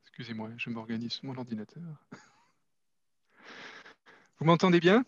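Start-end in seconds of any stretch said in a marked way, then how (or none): 4.4-5.0 raised voice. Shown as a demonstration. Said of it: "c'est suffisamment fort".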